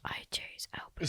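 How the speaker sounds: whispering. Real, not acted. Whispered answer aside.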